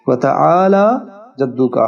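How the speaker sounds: وتعالی جدک very slow, لام stretched two beats; correct recitation. Shown as a demonstration. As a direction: hold the لام in وتعالی long. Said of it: "حرف لام کو دو حرکت کے برابر کھینچیں گے". (لام کھینچ کر آہستہ پڑھنا)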